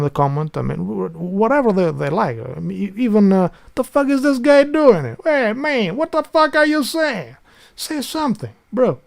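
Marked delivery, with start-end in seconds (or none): 3.7-9.0 high, loud mock outrage at a silent guest; exasperated Italian bro. Imagined viewer scolds the silent newcomer, high and exasperated. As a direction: play a fed-up Italian telling the guy to speak up.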